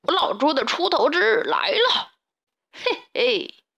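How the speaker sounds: high, swooping villain's gloat with a snicker; scheming pig. A narrator's reading. Stray pig plotting to sneak into the henhouse, gloating. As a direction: gloat slyly over the coming plot.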